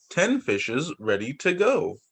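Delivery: normal talking voice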